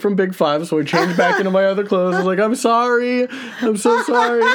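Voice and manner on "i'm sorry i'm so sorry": high, pleading sorry voice; pleading apology. Retold or imitated. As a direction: beg her to forgive you. Apologizing to angry girlfriend.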